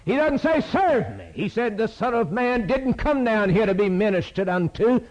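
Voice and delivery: soaring revival cry; preacher's shout. Real, not acted. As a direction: lift the congregation with it.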